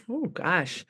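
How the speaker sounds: ordinary talk, nothing marked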